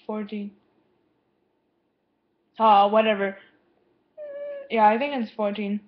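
normal talking voice

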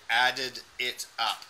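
"Added it up." with each word separate; slow, careful "Added it up.". Shown as a demonstration. Teacher showing the student the words said slowly. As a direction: say "Added it up." slowly, not rushing. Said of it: "I missed the it".

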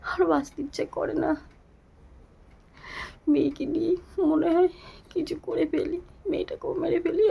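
on the edge of tears, pleading; a mother breaking down, begging for help; tearful despair. Real, not acted. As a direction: voice pitched high and strained with grief.

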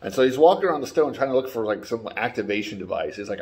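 ordinary talk, nothing marked

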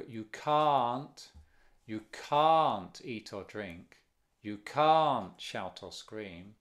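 slow, with "can't" stressed; British stressed can't. Shown as a demonstration. Teacher modelling British English can't, slow and stressed. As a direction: lean on each "can't" in a British way. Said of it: "En inglés británico".

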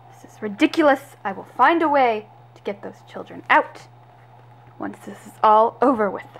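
normal talking voice

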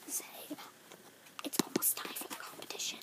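whispered prompt; feed the line in a whisper. Real, not acted. Prompting the other child's line.

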